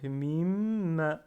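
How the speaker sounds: MIM with a long, loud held M; M retenu. Shown as a demonstration. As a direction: hold back the M in "mim" before releasing it. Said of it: "je la retiens la mim". (m prolongé et appuyé dans « mim »)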